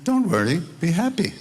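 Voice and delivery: high, lilting don't-worry voice; cheerful quote. Retold or imitated. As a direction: sing it out lightly, carefree. Quoting Mayor Baba's cheerful words.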